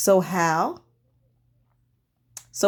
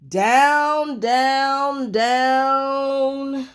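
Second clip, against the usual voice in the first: sliding down; 5 semitones higher, much slower than usual. A narrator's reading. Narrator sliding Jack down the beanstalk, drawn out. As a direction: draw it out like a long slide.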